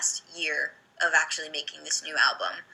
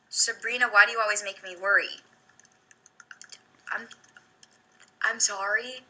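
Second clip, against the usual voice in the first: normal talking voice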